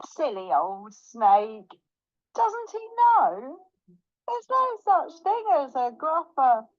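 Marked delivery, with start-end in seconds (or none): 2.3-6.7 high, amused crowing; lilting, pitched-up smugness; gloating mouse. Acted. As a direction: crow over the one you fooled. Mouse gloats over fooled Snake.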